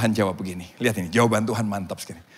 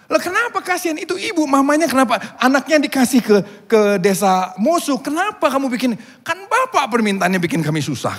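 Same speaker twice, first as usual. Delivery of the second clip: put-on high, loud argument over the child; distressed protest; 12 semitones higher, 6 dB louder than usual. Missionary and villagers argue over exchanged baby, agitated protest. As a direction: remonstrate urgently, voice pitched up.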